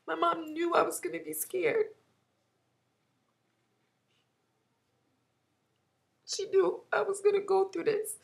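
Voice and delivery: words forced out through sobs; crying. Real, not acted. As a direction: voice cracking as she fights back tears. Grieving mother's death, breaking down.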